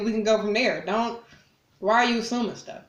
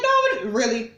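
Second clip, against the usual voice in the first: very high, loud disbelieving no; shocked mock squeal; outraged disbelief; incredulous squeak; 4 semitones higher, 5 dB louder than usual. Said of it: "It's like".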